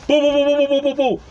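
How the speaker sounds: pounding "boo-boo-boo" sound effect; imitated door banging. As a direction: mimic frantic banging with your voice. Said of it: "they came banging on the door".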